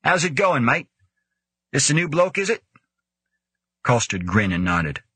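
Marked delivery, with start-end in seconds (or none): 0.0-2.6 high, hearty greeting voice; character voice. A narrator's reading. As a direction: voice a cheery bloke, pitched up.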